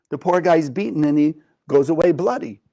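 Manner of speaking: ordinary delivery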